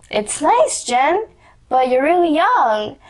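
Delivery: a hint of a mild father's caution; gentle dad. Dad sees the tattoo, lightly voiced mild caution.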